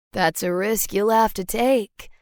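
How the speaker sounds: smooth male character voice; rich, sonorous man. A narrator's reading. Mystery man answering her challenge, rich and sonorous. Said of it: "His voice is rich and sonorous".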